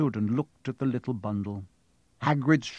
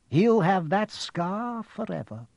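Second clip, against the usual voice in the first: Dumbledore voice; 6 semitones higher, slower than usual. Acted.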